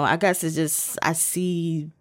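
ordinary delivery

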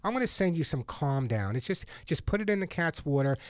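ordinary talk

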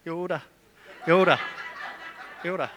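Yoda with a rolled Scottish r, "Yorda"; Scottish accent. Retold or imitated. Pastor jokes to the church in a Scottish accent. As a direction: say Yoda like a Scot, rhotic r. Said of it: "if a Scottish person said it".

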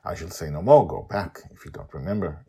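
ordinary delivery, nothing marked

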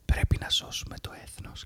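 whispered mock manifesto, fully voiceless; ASMR whisper. Host re-enacting his far-right manifesto ASMR video, whispering. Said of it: "έκανα A S M R με ακροδεξιά μανιφέστα".

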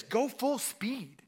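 slow, drawn-out solemn command; God's command. Retold or imitated. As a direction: lay each word down slowly as God. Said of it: "God says".

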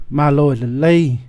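ordinary talk, nothing marked

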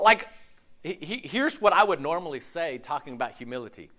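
ordinary talk

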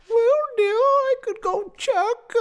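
high, slow fluty mom voice; piping, drawn-out housewife; genteel mama. Acted. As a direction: put on a high-pitched wifely voice. Narrator plays Mama Slooverton.

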